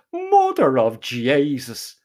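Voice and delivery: long, drawn-out agonised oath; slow, acted exclamation of agony; cry of pain. A narrator's reading. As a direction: stretch the curse slowly through the pain. Narrator relives his arm breaking.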